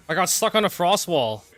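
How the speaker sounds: loud, high, exasperated complaint; frustrated outburst. Real, not acted. Stuck on a frost wall in game.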